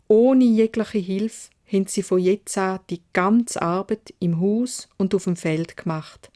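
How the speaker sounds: usual speaking voice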